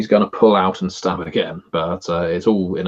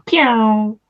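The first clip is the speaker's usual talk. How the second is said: high, loud "pew" ricochet; zing it out like a bullet bouncing off a door frame; ricochet sound; 5 dB louder than usual. Pretend. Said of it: "everything has to make a ricochet sound".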